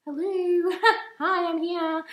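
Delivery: high, laughing little hello; giggly high-pitched greeting; playful high voice. Pretend. Playful greeting after look at me.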